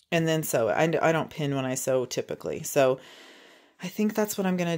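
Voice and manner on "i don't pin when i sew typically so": ordinary talk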